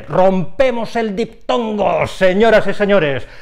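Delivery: high, drawn-out proclamation of the broken diphthong; showman's announcement. Pretend. Teacher announcing the rule to the class, mock showman. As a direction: proclaim it like a show announcer, stretching DIPTONGO.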